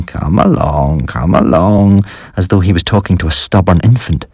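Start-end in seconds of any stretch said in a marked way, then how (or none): 0.0-2.0 slow coaxing murmur. A narrator's reading. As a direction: coax a stubborn infant. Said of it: "He kept murmuring".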